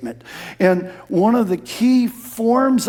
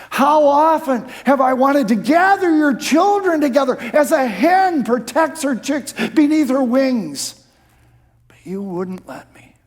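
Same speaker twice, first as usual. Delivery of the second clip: loud, high lament that fades out at the end; lamenting cry; 7 semitones higher, 5 dB louder than usual. Retold or imitated. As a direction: cry out over the city, then let it sink. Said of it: "he sobs and says".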